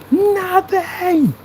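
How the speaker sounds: high, loud, long-stretched gloating "Nothing"; crowing, drawn out and falling at the end; drawn-out taunt. Real, not acted. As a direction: stretch it out and rub it in.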